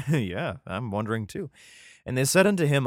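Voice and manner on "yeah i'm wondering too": ordinary talk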